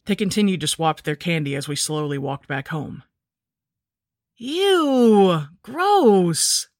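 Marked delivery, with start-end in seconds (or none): 4.3-6.7 high, loud, drawn-out disgust; exaggerated childish revulsion; disgusted kid. A narrator's reading. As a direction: whine it out like a grossed-out little boy. Kid disgusted by candy, story.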